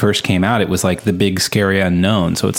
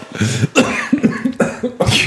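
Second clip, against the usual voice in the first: high giggle. Real, not acted. Laughing at own joke.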